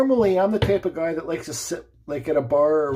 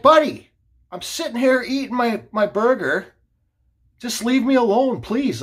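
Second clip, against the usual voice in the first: exasperated plea; 7 semitones higher, 4 dB louder than usual. Retold or imitated. Acts out exasperated plea in quarrel.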